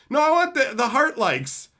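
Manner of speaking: high, loud whine; mock whining. Streamer mocking attention seekers, high loud whine. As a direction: whine it like a child. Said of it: "I don't understand".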